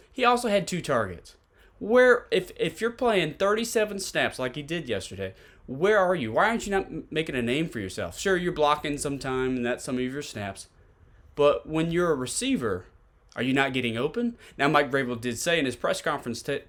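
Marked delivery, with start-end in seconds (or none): none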